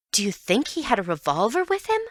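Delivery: ordinary delivery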